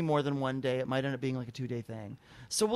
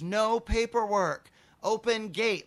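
slow, higher-pitched words for a non-speaker; simplified broken-English command; 6 semitones higher, 3 dB louder, slower than usual. Retold or imitated. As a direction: spell it out slowly, exasperated. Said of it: "doing hand gestures".

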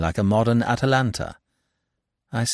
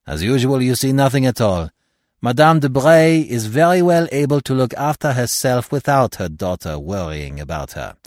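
put-on louder French-accented retort, settling down; Poirot; 5 semitones higher, 6 dB louder than usual. A narrator's reading. Detective corrects his blind friend, accented and emphatic. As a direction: put him in his place.

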